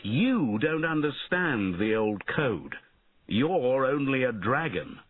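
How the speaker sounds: mildly angry, emphatic knight; shouted. A narrator's reading. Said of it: "shouted Bowen".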